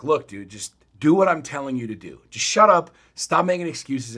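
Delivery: exasperated coach retelling his outburst; retold exasperated order. Retold coaching against resistance.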